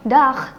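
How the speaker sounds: "dag" said slowly with a rasping final G; Dutch greeting. Shown as a demonstration. Presenter teaching a Dutch hello, slow careful pronunciation. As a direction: draw out "dag" with a throaty end. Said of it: "sapaan di bahasa Belanda".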